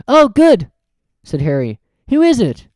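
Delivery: eager to know; Harry's voice. Acted.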